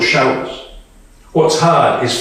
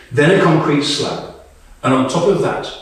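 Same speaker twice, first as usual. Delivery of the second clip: normal talking voice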